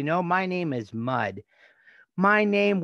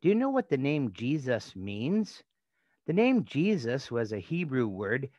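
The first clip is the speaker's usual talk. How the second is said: normal talking voice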